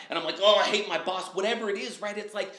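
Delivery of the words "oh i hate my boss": mock whine. Acted complaint about boss.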